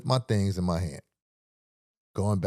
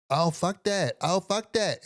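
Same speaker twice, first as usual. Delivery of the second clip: high, hammed-up repeated outburst; mocking ranting stranger; 8 semitones higher, slower than usual. Tall stranger ranting at him, high mocking outburst. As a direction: imitate the tall man working himself up. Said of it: "in his".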